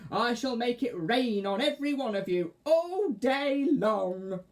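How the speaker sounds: high, loud witch. Acted. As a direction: crow it like a witch.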